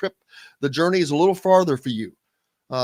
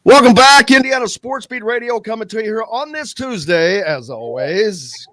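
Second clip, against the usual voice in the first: big, high-pitched opening burst, settling as it goes; radio show welcome; 6 semitones higher, 9 dB louder than usual. Real, not acted. Host welcoming listeners back to the sports show, punchy radio-intro delivery. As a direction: hit the welcome loud, then stretch the last words.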